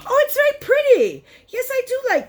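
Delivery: gasp, then high-pitched gushing; excited delight. Real, not acted. Vlogger seeing the palette shades, excited delight. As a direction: squeal over it, high and thrilled.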